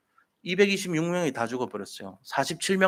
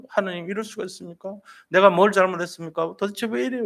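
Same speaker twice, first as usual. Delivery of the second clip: anguished cry, pitched higher; crying out to God; 5 semitones higher than usual. Retold or imitated. Grieving father in his cabin crying out to God, high anguished plea. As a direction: plead with God in raw grief, voice climbing. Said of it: "이러면서 막 부르짖어요".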